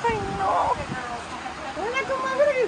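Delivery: sing-song hello to the animals; high playful greeting. Real, not acted. Greeting the alpacas, high and playful.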